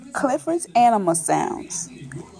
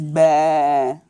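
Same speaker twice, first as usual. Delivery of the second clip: low, drawn-out bleat; sheep baa; 8 semitones lower than usual. Acted. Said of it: "the sheep says".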